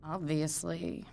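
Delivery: low, slow and dry; flat sarcastic put-down; sarcastic obviously under the breath; deadpan obviously. Real, not acted. Dry retort to writer.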